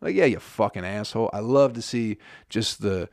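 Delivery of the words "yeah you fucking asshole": usual speaking voice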